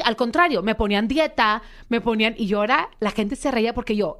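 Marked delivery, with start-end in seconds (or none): none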